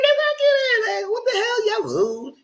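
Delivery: outraged shriek. Acted. Kiki yelling after the rude boys, very high outraged shriek. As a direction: shriek it like she's been slighted. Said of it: "She was pissed".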